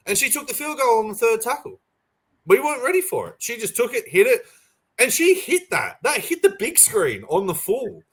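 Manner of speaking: breathless commentary-style retelling; excited replay. Retold or imitated. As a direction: call it like the moment is happening again. Excitedly replaying the winning field goal.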